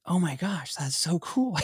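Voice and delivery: excited daughter. Retold or imitated. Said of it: "She's like".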